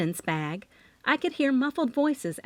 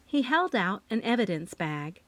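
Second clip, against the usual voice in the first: usual speaking voice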